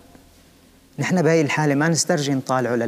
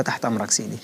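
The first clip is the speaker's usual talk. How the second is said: retold soft, resigned compliance; submissive reply; 3 semitones lower, 5 dB quieter than usual. Beaten prisoner agreeing to carry the sick man, submissive. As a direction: give in with a softened voice.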